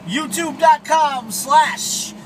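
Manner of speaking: usual speaking voice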